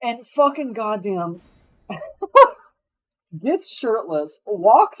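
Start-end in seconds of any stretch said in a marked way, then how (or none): 1.9-2.5 loud, high laughing fit; high burst of laughter. Real, not acted. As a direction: break into a shrieking laugh. Bursts out laughing mid-story.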